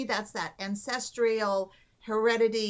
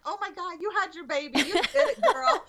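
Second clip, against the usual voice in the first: high, laughing cheer that grows louder; excited congratulations; 7 semitones higher, 4 dB louder than usual. Retold or imitated. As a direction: celebrate with her, building to a loud finish. Acts out congratulating the new mother.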